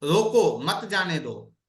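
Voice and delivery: firm रोको, break, मत जाने दो as one phrase; pause after रोको. Shown as a demonstration. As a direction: stop after रोको, run मत जाने दो together. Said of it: "आप उसे बोलेंगे कि भाई".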